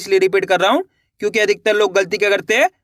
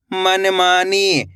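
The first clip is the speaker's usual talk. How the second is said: मनमानी stretched slowly, syllables joined; slow blended word; much slower than usual. Shown as a demonstration. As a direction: draw मनमानी out slowly for the class. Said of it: "कैसे पढ़ेंगे?". (धीरे-धीरे मनमानी)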